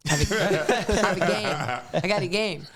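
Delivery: giggling punchline; laughing. Real, not acted. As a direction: laugh your way through it. Laughing at own joke.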